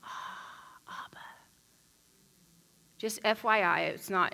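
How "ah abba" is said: soft whispered prayer. Shown as a demonstration. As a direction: whisper "Ah, Abba" on breath. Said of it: "the sound Ah in Aramaic".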